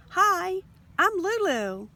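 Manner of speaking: high, loud bubbly hello; bouncy greeting. Acted. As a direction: bound in with a big cheerful hi. New girl bounds up.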